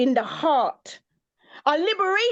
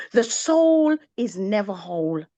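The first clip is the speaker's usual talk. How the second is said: ordinary delivery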